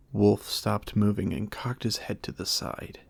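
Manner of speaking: ordinary delivery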